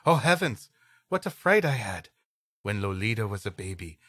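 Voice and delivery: ordinary talk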